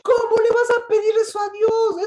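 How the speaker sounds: high, loud indignant protest; preacher acting out the outraged believer's cry; indignant outcry. Preacher voicing an indignant believer to the congregation, loud and high. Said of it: "Eso es lo que está diciendo el indignado".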